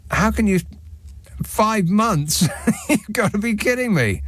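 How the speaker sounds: laughing in disbelief; can't believe it, laughing; incredulous laughing. Real, not acted.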